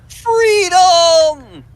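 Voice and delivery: high cry of freedom; triumphant shout. Pretend. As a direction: yell it out in triumph. Cheering duck escaping.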